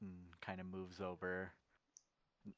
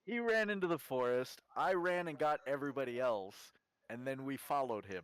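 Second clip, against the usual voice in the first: louder, higher protest; loud self-defence; 4 semitones higher, 7 dB louder than usual. Acted. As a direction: argue back loudly in your own defence. Defending himself in acted quarrel.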